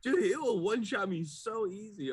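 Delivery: normal talking voice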